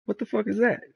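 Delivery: high, incredulous mock outrage; mock disbelief. Rock seeing the cheap gorilla suit, high mock disbelief. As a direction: react as if he can't believe it. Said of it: "The Rock is like".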